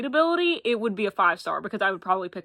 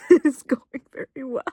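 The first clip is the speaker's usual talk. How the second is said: laughing through the words, louder; laughing repeat; 4 semitones higher, 6 dB louder than usual. Real, not acted. Vlogger reporting the movie's going great, laughing. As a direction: giggle it out, delighted.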